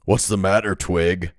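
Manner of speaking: loud, low, mocking gang leader; low rough voice. A narrator's reading. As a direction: taunt him in a rough low voice. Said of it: "came the low voice".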